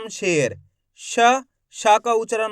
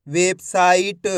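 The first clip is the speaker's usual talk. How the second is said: Website stretched out slowly as one word; slow blended reading of website; 3 semitones lower, much slower than usual. Shown as a demonstration. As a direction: draw out website slowly, joining the syllables. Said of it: "एक एक अक्षर का उच्चारण करके".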